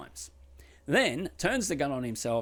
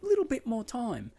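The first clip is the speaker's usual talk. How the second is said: put-on hesitant girlfriend; 11 semitones higher than usual. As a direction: back off politely and unsure. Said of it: "she's just like".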